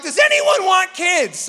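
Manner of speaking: exasperated parent. Retold or imitated. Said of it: "you're like".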